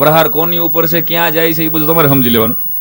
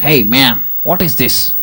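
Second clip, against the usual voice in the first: very slow, softer foreign drawl; foreigner's English; 3 semitones lower, 4 dB quieter, much slower than usual. Acted. American stranger asking the villager about his moustache, slow drawl. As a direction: play a puzzled American tourist.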